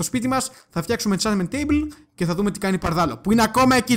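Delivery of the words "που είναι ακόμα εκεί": loud, raised punch on "ακόμα εκεί"; emphatic stress. Real, not acted. Player tells viewers it is still there, emphatic stress. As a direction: hammer home "ακόμα εκεί", higher and louder. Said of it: "το τονίζω".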